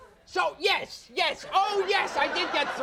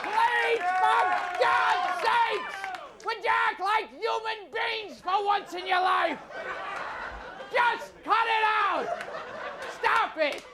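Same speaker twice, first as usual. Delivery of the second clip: mock pleading shout; 7 semitones higher, slower than usual. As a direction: beg the audience to stop, hammed up. Pleading with cheering audience to stop.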